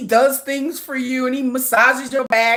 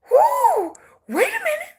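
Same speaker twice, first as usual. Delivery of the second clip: high-pitched startled whoo; mock surprise; slower than usual. Playing fans who hit the end, a high startled whoo. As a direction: jump up in pitch, caught off guard.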